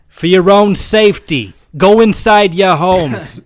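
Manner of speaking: slow, loud Boston robot announcement; Boston accent. Pretend. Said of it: "super thick Boston accents".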